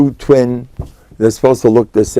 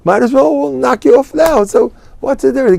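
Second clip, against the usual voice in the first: much higher, offhand and unbothered; callous shrug; 12 semitones higher than usual. Retold or imitated. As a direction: brush off the plea as nothing. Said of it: "So he looks at him".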